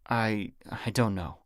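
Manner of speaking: slow, faltering stammer; nervous hesitation. A narrator's reading. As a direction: stumble over the words, caught out. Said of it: "Daniel hesitated".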